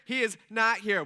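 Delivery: loud, emphatic, hyped-up announcement; pumped angel. Pretend. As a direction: proclaim it as an excited angel. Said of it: "this angel's probably pretty pumped".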